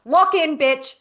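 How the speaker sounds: loud, high scolding, breaking into a laugh; retold yelling. Coach retelling how she yelled at her client, loudly. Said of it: "which made me yell at her".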